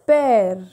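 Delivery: Père as a long, open "pehr"; accent grave pronunciation. Shown as a demonstration. Teacher giving an example of accent grave, Père as "pehr". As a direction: model Père clearly as "pehr". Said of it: "has the sound of A".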